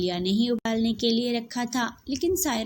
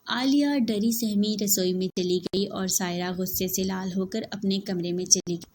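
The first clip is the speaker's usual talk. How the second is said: usual speaking voice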